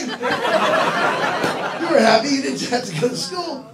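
ordinary delivery

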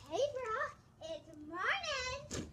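slow, drawn-out creepy greeting. Shown as a demonstration. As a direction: stretch it out slowly, eerie and strange. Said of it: "so pretend she's laying down".

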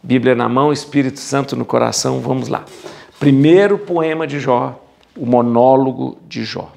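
normal talking voice

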